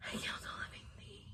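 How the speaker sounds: a soft, breathy broken whisper; whispered guilt. Retold or imitated. Sitting with Jim after the crash, whispering her guilt. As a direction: barely get the words out.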